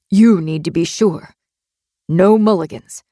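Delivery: firm, controlled captor voice; warning captor. A narrator's reading. Captor tells captive to be sure, measured warning. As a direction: warn with measured firmness.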